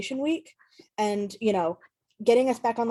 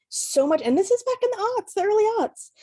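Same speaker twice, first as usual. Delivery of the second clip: real emotion pushing the voice much higher; getting emotional; 11 semitones higher, 3 dB louder than usual. Real, not acted. To the hosts, recalling her teenage fears, emotional and much higher. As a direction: voice lifts and strains as feeling rises. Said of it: "Sorry, I'm getting emotional".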